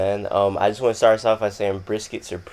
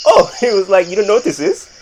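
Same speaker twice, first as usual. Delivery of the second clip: imitating an African dad with an African accent, higher and louder; mock African accent, pitched up and laughing; 9 semitones higher, 6 dB louder than usual. Quoting friend's dad at dinner, mock African accent. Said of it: "strong African accents".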